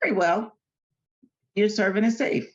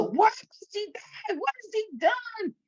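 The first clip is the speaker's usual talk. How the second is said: much higher, softer, slow plea; pleading son; 4 dB quieter than usual. Acted. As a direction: beg for his friend's life. Jonathan confronts his father.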